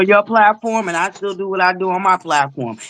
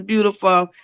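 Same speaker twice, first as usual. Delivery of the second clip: ordinary delivery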